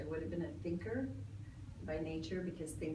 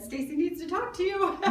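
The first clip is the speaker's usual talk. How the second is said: amused quoting; 12 semitones higher, 7 dB louder than usual. Retold or imitated. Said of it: "and said".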